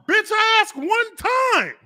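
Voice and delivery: very high, slow, loud retort; mock-outraged yell, stretching each word; indignant shout. Host replays his comeback to the player, indignant.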